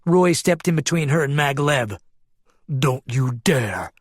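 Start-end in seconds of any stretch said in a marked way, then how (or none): 2.7-3.9 slow, growled warning; menacing growl. A narrator's reading. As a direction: growl it word by word. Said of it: "Roy growled".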